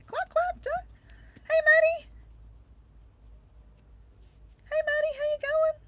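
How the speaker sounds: very high, squeaky pretend duck; talking duck. Duck socks greet Maddie online, talking duck voice. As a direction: quack and chirp hello like a cartoon duck. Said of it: "my ducks are talking to you".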